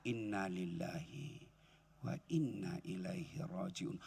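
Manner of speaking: low, slow, very soft; slow heartfelt recitation. Shown as a demonstration. Preacher showing dhikr while stroking chest, slow and soft. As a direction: let each word settle on the chest. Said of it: "Ngucapnya sambil usap dada".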